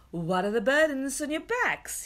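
lightly voiced inquisitive dwarf; questioning dwarf. A narrator's reading. Dwarf questions the captive children, lightly acted.

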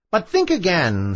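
high witch voice, cautioning; high-pitched witch's warning; warning sea witch. A narrator's reading. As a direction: warn her sharply as the witch. Witch warns the princess.

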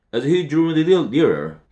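ordinary delivery, nothing marked

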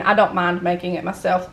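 ordinary delivery

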